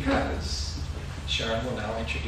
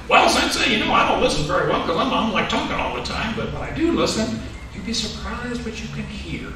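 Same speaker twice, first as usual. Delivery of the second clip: put-on higher, louder, fast chatty kid voice; voicing Barkley; 7 semitones higher, 7 dB louder than usual. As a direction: play the kid who talks all the time. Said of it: "So, so Barkley, what'd you think".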